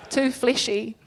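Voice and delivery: ordinary talk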